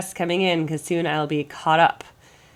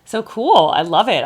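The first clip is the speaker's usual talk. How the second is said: excited; 5 semitones higher, 9 dB louder than usual. Real, not acted. Enthusing about the breakdown.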